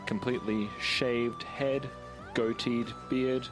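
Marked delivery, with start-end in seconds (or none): none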